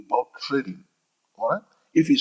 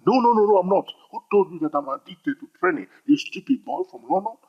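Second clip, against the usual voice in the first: defensive trader; 6 semitones higher than usual. Acted. Acted denial of trading addiction.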